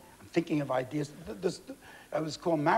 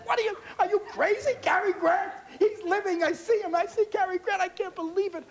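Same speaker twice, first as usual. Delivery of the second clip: much higher, breathless with excitement; starstruck disbelief; 13 semitones higher than usual. Retold or imitated. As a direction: gush like a starstruck fan, higher. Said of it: "I said".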